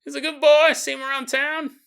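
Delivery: put-on very high, loud country sheriff accent; sheriff's accent, exaggerated. Sheriff complimenting the dead man, hammed-up high country accent. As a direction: squeal the praise in a hammed-up rural accent. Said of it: "I don't think the sheriff shows up and compliments your son".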